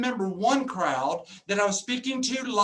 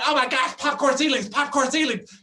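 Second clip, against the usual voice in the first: higher, louder mock-shocked buyer; mock horror; 4 semitones higher, 4 dB louder than usual. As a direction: gasp over popcorn ceilings like a catastrophe. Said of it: "They're coming in going".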